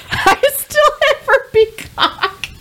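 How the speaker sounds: high, slow squeal through laughter; helpless laughter, pitch shooting way up; real laughing confession. Real, not acted. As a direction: crack up while getting the words out. Cracks up confessing to stealing.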